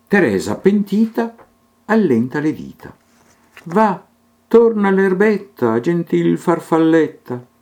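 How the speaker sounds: lilting sing-song verse reading; poem recitation. A narrator's reading. Reader reciting a children's poem, lilting and rhythmic. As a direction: recite it in steady rhyming rhythm.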